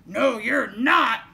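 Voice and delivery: slow, loud, menacing troll. A narrator's reading. Troll refusing the little goat, loud and slow. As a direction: boom it out word by word. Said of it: "said the troll".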